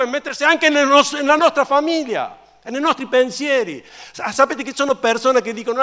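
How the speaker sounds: urgent high-pitched sermon, hammering each repetition; impassioned preaching. Real, not acted. Preaches with mounting force.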